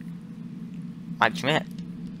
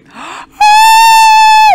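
loud, high scream-gasp; a huge startled gasp at the scare; shocked gasp; 16 dB louder than usual. Real, not acted. Jumpscared by the animatronic, loud high gasp. Jump scare in horror game.

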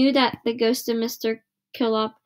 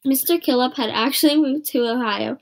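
ordinary delivery, nothing marked

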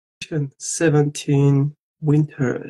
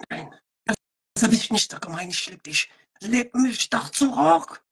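mock tender German; 6 semitones higher, 4 dB quieter than usual. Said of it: "German is such a lovely language for love".

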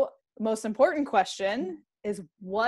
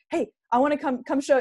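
excited; 5 semitones higher, 3 dB louder than usual. Retold or imitated. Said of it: "they're like".

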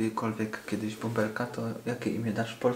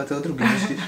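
laughing; 2 semitones higher, 5 dB louder, much faster than usual. Real, not acted. Laughing at talk of second child.

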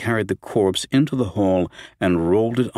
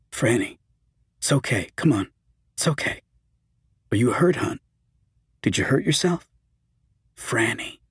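soft, soothing reassurance; calming; 2 semitones higher, 4 dB quieter than usual. A narrator's reading. Steve calms sobbing Fran after the attack, soft soothing. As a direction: gently settle her down, softer. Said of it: "tried to calm her".

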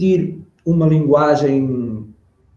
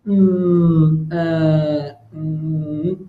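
long, droning "hum, ah, hum"; hesitant demo of verbal filler; slow to answer; much slower than usual. Shown as a demonstration. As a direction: stall with drawn-out fillers before answering. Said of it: "demorar muito a responder".

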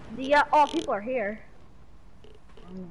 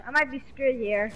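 ordinary talk, nothing marked

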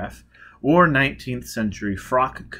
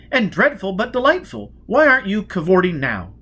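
much higher and louder, flirtatious; gushing society lady; 10 semitones higher, 7 dB louder than usual. Acted. Lady Shrapnel teasing Four Mile, high and loud. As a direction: play the giddy grande dame.

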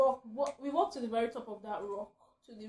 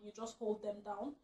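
ordinary talk, nothing marked

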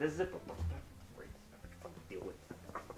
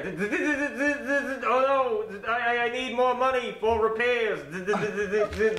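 stuttering broken robot; robot glitching; 7 semitones higher than usual. Pretend. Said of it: "Shouldn't you, like, short circuit?".

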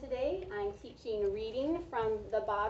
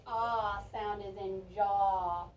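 slow, stretched teacher demo on jaw; slow vowel demo; 3 semitones lower, much slower than usual. Shown as a demonstration. Teacher confirming the answer for first graders, slow and stretched. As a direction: draw out jaw so the ah sound is clear. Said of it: "listen for the ah sound".

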